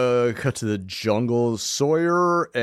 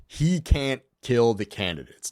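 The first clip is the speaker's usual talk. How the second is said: ordinary delivery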